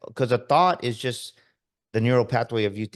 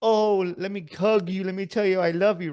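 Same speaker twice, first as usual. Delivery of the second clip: mock coddling; 8 semitones higher, 3 dB louder than usual. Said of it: "what do we do?".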